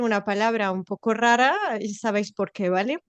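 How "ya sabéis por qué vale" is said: ordinary talk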